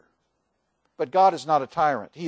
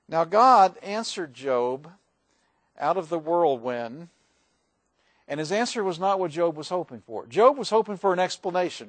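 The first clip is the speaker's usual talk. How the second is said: ordinary talk, nothing marked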